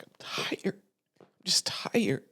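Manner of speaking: soft unvoiced whisper of exhaustion; tired whisper. Confessing to her best friend, a drained whisper. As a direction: breathe it out, worn down.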